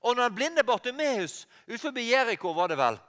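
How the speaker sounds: ordinary delivery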